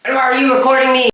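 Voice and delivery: caught-out yelp; startled shriek. Acted. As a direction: jump at being caught on camera. Father notices being filmed.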